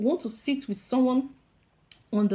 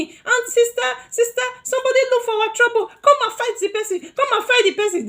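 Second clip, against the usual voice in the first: put-on high, fast, loud kids begging sister; urgent childish begging, sister sister; excited pleading; 14 semitones higher, 6 dB louder than usual. Cousins begging their big sister to fight for them, high and urgent.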